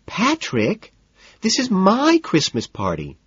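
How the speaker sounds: very high, slow protest; petulant high-pitched insistence; whiny hostess. A narrator's reading. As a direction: whine indignantly at a partner. Evelyn whining at Patrick.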